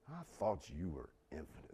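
low, soft and scornful; quiet disdain. Retold or imitated. Preacher repeating Habakkuk's words to God in quiet disdain. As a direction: let the contempt simmer under the breath. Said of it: "you feel the disdain".

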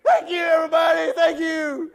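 loud, high hollered thank-yous to the crowd; hollering thanks. Retold or imitated. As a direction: wave at the crowd and yell your thanks like a hero. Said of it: "I start hollering out".

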